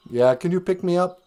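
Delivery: ordinary talk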